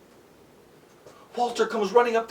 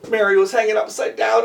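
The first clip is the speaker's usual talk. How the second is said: ordinary delivery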